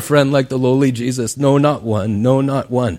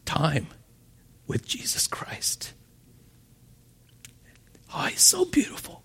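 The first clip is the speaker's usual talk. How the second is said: hushed, breath-only whisper with long pauses; awed whisper; 3 semitones higher, 14 dB quieter than usual. Real, not acted. Preacher dwelling on time with Jesus, awed whisper.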